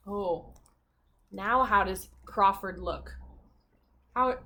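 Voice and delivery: ordinary delivery, nothing marked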